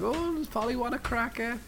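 put-on sing-song baby talk to a parrot; mocking sultan voice.

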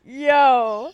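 loud, emphatic oh; excited. Real, not acted. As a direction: react with big excitement. Excited reaction.

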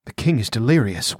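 ordinary delivery

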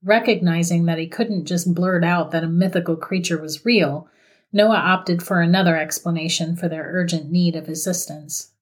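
usual speaking voice

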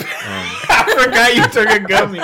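high squealing laughter; laughing. Real, not acted. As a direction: giggle through the words. Laughing at stoned friend.

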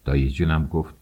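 plain reading voice

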